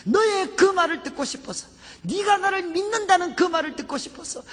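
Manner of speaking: high, forceful preaching as the Lord; impassioned voice of God. Retold or imitated. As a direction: pour out God's affirmation with mounting fervor. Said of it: "주님이 그때 제 마음속에 말씀해 주셨어요".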